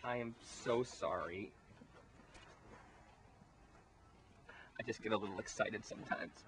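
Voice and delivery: sheepish apology. Acted. Tina apologizing after outburst.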